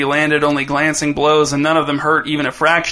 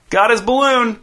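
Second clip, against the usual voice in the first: put-on higher, proud kid's announcement; announcing proudly; 8 semitones higher than usual. A narrator's reading. Dez shows off the balloon to his brother, proud call. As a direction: show off the prize. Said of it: "he announced".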